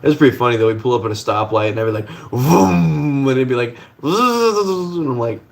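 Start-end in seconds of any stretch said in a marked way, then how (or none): 2.3-3.3 high vroom sliding down; imitated engine rev. Revving at a rival Honda at the stoplight, mimicked vroom. 4.0-5.0 high buzzing whine that slides down; imitated Honda whine. Honda answering at the stoplight, mimicked high buzz.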